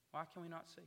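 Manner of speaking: normal talking voice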